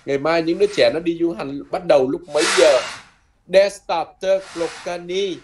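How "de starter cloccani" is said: slow model phrase. Shown as a demonstration. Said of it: "chín giờ bắt đầu nha".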